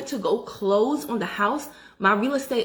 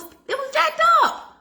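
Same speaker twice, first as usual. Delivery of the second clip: very high, slow, stretched outrage; heated outrage; 3 dB louder, slower than usual. Real, not acted. Still upset years later about the agent, soaring outrage. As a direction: let it soar in disbelief. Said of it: "I'm getting heated about it all over again".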